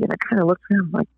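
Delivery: ordinary talk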